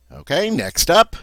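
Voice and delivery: ordinary delivery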